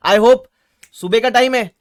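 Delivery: ordinary talk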